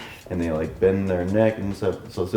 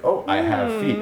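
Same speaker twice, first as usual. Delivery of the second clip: much higher, amazed little oh; delighted discovery. Shown as a demonstration. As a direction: sound newly amazed at your own feet. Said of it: "So you do that, like".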